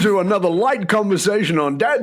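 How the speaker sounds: mock podcast welcome; mock announcer intro. Real, not acted. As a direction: announce it like a radio host, tongue in cheek.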